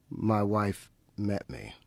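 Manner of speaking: normal talking voice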